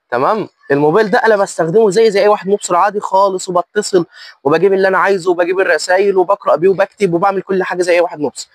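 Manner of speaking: usual speaking voice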